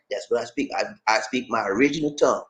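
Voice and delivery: usual speaking voice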